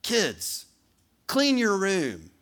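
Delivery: stern parent command, slow and loud; parent giving an order. Acted. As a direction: order the kids, drawing out clean. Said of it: "we're gonna role play".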